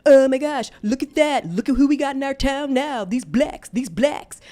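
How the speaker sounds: shrieked high and loud in disgust; loud, disgusted mock shriek. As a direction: yell it out as the sneering girl.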